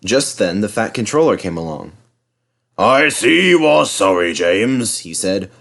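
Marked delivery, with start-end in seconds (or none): 2.8-5.0 stern boss voice. Acted. Fat Controller scolds James.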